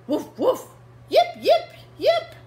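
high, loud playful puppy yips; puppy barks. Acted. Reading to kids, the puppies bark and yip playfully.

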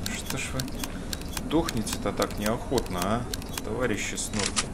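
normal talking voice

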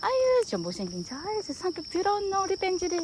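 high doting coo. Acted. Owner cooing teasing insults at the dog, high doting. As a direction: tease him lovingly up high.

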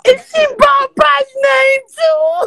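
high, loud, tearful wailing; crying shout. Real, not acted. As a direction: cry out the words, voice breaking high. Crying while telling story.

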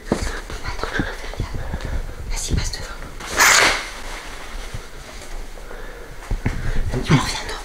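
hushed whisper in hiding; whispering while hiding. Real, not acted. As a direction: whisper so they won't hear.

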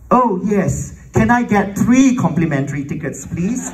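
high, emphatic eager request; eager acceptance. Retold or imitated. Composer begging the orchestra lady for tickets, eager.

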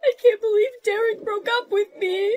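high, whining acted crying; tearful. Girl crying to her friend over breakup, tearful. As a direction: wail it through tears.

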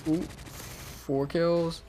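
ordinary delivery

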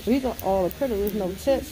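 muffled by two masks; covered mouth speech; through a face mask. Real, not acted. Seen 1: Leopard-print cloth mask over nose and mouth, blue mask beneath.

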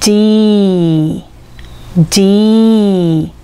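"G" as long ee, then a stretched "dee"; slow, drawn-out demonstration of "G" as "dee"; letter D sounded out. Shown as a demonstration. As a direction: hold the ee in each "G", read as E and D. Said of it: "イーという母音からできています".

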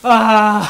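loud exclamation. Real, not acted. Reacting after the drink.

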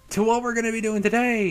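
loud, high-pitched show-opener shout; hyped intro. Real, not acted. Host finally launches the video for viewers, hyped. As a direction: belt it out like a big intro.

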